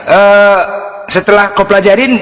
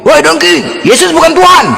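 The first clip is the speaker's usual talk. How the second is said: higher mock heckler calling out from behind; provoking heckler; 9 semitones higher than usual. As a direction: taunt him from the back, pitched up. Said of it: "ada yang ngomong gini". (teriakan mengejek dari belakang, nada tinggi)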